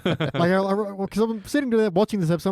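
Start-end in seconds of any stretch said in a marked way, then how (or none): none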